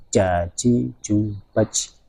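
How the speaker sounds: "ja, ji, ju, baj" with the sound stopped sharply; Arabic jim with a cut-off sound; jim drill with syiddah. Shown as a demonstration. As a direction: sound out the jim with a held stop. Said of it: "itu kan tertahan suara".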